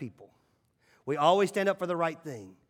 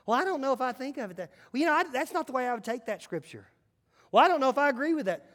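a touch of a fussy skeptic, higher and quick; mock doubter; 6 semitones higher, faster than usual. Pastor mimicking a doubting churchgoer, lightly fussy. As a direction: grumble like a nitpicking churchgoer. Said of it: "there's nobody saying".

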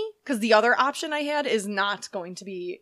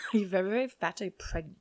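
put-on softer, muddled Anna Delvey accent; attempted Anna Delvey accent; 2 semitones lower, 5 dB quieter than usual. Host insulting co-host, made-up accent. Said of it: "this is not Anna Delvey".

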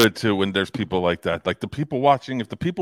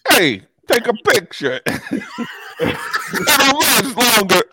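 high, loud, drawn-out taunt; hammed-up mock confrontation; heckling character voice; 14 semitones higher, 6 dB louder, much slower than usual. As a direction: holler it slowly with a laugh. Continues acting Joe confronting a gawker.

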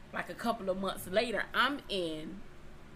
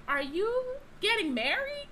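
pitched way up, stunned; high shocked question; 13 semitones higher than usual. Retold or imitated. Asking him on the phone, high and shocked. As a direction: demand it in disbelief.

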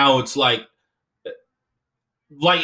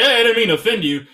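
quick, louder, higher "didn't mean to offend you"; mock breezy apology; 7 semitones higher, 5 dB louder, faster than usual. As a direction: toss off a light apology, fast. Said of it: "more like".

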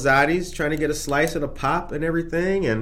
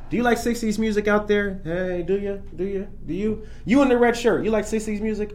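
teasing call-out; 6 semitones higher than usual. Real, not acted. Calling out to the live audience.

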